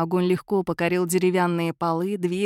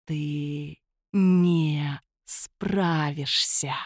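slow, low ghostly hiss; dark whisper behind you; 4 semitones lower, much slower than usual. A narrator's reading. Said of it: "зашипел жуткий голос за спиной".

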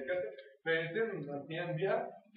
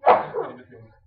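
much higher and louder, stressing كلمات; loud emphatic repeat; 8 semitones higher, 17 dB louder than usual. Shown as a demonstration. Teacher repeating the rule loudly for trainees. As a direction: call it out to the class. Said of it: "ينزل في هذه القاعدة خمس كلمات".

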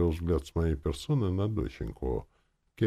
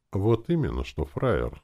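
restrained reply, settling down at the end; 6 semitones higher, slower than usual. A narrator's reading. Narrator taking up the uncle's insult, restrained. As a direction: answer the insult with self-control. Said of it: "сказал я сдержанно".

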